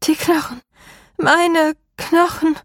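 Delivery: high and slow, a pained wail; moaning plea. A narrator's reading. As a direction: drag the words out in agony. Trapped woman moaning in pain.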